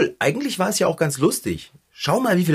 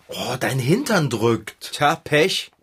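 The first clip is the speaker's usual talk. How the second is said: ordinary delivery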